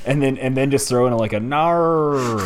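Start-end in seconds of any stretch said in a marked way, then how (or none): 1.4-2.4 loud, high Aussie-style nar; Falcon Paladin nar. Pretend. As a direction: belt out nar like the streamer. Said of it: "my best Falcon Paladin impression".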